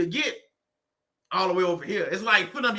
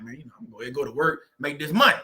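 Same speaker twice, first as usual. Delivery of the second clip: low, fast resigned mutter; grudging self-talk; resigned shrug; 6 semitones lower, much faster than usual. Retold or imitated. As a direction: rush it like you're talking yourself into it. Resigned to working for money.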